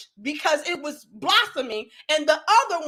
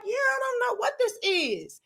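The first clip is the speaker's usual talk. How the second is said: higher and softer, puzzled; higher, hushed, bewildered child; confused kid's reply; 8 semitones higher, 5 dB quieter than usual. Retold or imitated. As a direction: answer small and unsure like a nine-year-old. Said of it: "And I'm like".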